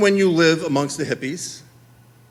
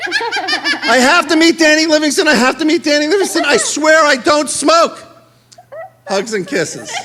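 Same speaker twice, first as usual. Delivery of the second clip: higher, louder gushing fan voice broken by laughter; swooning girl voice with laughter; laughing through it; 10 semitones higher, 4 dB louder than usual. Retold or imitated. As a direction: repeat the fan letter while cracking up. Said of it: "Denise Cantrell".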